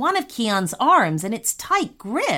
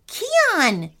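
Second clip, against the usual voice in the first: high-pitched call, moderately raised voice; shout; 9 semitones higher than usual. A narrator's reading. Boy calls his brother as robot charges, shouting. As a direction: call out high to get attention. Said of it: "shouted Rami".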